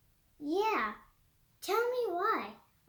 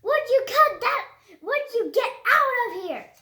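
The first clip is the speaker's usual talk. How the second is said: loud, higher furious shout; angry yell; 5 semitones higher, 7 dB louder than usual. Acted. Petey yelling at the kids to leave, angry shout. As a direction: throw them out loudly. Cat yells again.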